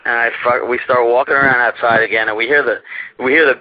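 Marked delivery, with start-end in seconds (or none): none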